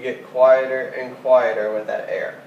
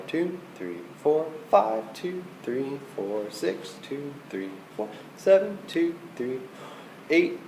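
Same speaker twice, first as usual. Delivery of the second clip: counting rests softly; 9 dB quieter than usual. Shown as a demonstration. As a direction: count the measures, softer and softer. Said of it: "Do it just like I did".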